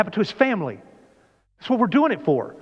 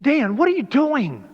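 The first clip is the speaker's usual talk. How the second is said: high, slowed questioning as God; God challenging; 6 semitones higher than usual. Pretend. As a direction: press him gently but pointedly. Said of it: "God's ever looked at you and said".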